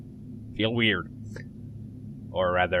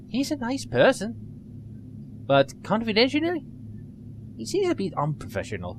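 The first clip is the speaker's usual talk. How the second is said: put-on higher, quick nerdy young woman; nerdy girl voice; 6 semitones higher than usual. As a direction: play her as an eager nerd. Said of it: "I gave her a little nerdy voice".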